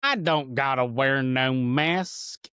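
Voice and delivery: slow drawling cowboy voice; cowboy accent. Retold or imitated. Trainer mimicking a stubborn cowboy tech, in a cowboy accent. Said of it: "I don't know why I did that accent".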